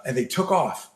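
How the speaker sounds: ordinary talk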